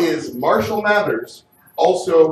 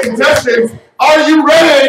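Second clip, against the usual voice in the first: hyped up; 9 semitones higher, 8 dB louder than usual. Acted. Host asks again louder.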